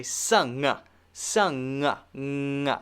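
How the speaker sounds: "sung" stretched into a long ng hum; held ng. Shown as a demonstration. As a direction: let "sung" run on into ng. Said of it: "if I continue that".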